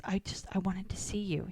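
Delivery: hushed whisper, no voice; a sneaky whisper up to the window. Retold or imitated. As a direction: whisper it so nobody else in the house hears. Boy sneaking under her window.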